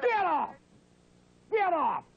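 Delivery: high, sharp dismissal; angry yell. Real, not acted. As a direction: yell to cut the caller off. Host cutting off the singing caller.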